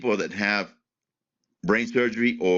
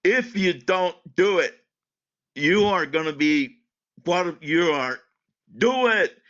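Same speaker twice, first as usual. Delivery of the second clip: crying, pleading doctor; 6 semitones higher than usual. Retold or imitated. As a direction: push the patient hard through tears. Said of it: "she was crying".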